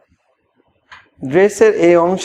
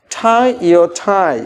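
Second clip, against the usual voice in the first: tie your tie stretched out very slowly; slow model phrase; much slower than usual. Shown as a demonstration. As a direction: draw out each word for learners. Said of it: "এই জন্য আমি বলেছি".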